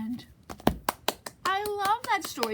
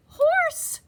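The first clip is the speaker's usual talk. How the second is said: storybook reveal; 13 semitones higher, 6 dB louder than usual. A narrator's reading. Big reveal in animal rescue.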